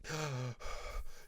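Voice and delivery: short, desperate gasps between words; the Nightwing heaves for breath, panicked; ragged gasps for air. A narrator's reading. Exhausted Nightwing gasping after arriving, ragged breathless gasps. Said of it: "in between gasps".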